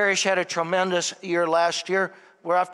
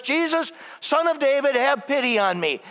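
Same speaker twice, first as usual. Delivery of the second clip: imitated shout to someone passing; retold yell; 7 semitones higher than usual. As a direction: call out like a blind beggar, raised and pleading. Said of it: "what does he yell out".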